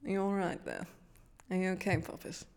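a hint of a purring grande dame; Joanna Lumley. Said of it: "let me try and do a Joanna Lumley".

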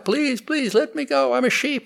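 high-pitched frightened begging; high, pleading young lion; pleading little sheep lion. Acted. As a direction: beg to be let go in a high, small voice. Captured sheep lion begs the lion.

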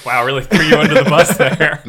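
loud, amused reaction through laughter; words bubbling out with laughter; laughing. Real, not acted. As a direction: laugh your way through the teasing. Host cracks up at the story.

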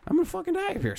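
hammed-up panic. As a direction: whine it out in terror. Panicking in the hotel.

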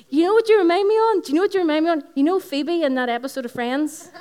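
husband mocking. Retelling her husband's Phoebe jibe, very high and loud with laughter. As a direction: squeal it out like a teasing husband. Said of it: "And then he goes".

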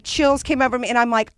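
usual speaking voice

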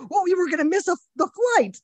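high, put-on wifely scolding voice; wife scolding him in a dream, high mock voice; mock wife reply. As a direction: voice her as a high-pitched scold. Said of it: "And she's like".

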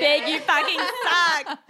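high, loud, drawn-out jeer; mock outraged shout. Echoing a friend's insult at the list, mock outraged shout. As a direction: shout the insult slowly, half laughing.

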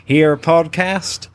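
put-on slow, drawn-out American accent; host the show in a put-on American drawl; hammed-up American host, breaking into a laugh; mock American accent. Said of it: "introduce the show in an American accent".

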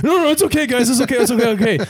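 high and loud, a rushed fake calm; panicked reassurance. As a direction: squeak out that everything is fine. Panicked reply through the door.

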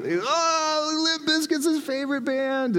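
high-pitched imitation of thrilled freshmen; mock excited squeal. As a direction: squeal it like a gushing fan. Mimics thrilled freshmen at his answer.

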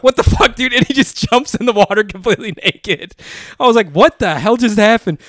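high, loud incredulous yelp; shocked outburst. Retold or imitated. Friend telling how he watched his buddy jump in naked, shocked outburst. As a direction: retell it in loud, high disbelief.

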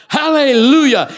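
high, loud hallelujah cry; hammed-up burst of celebration; jubilant shout. Acts colleague thrilled by the letter.

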